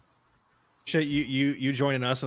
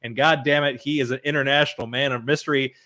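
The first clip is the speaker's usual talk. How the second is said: ordinary talk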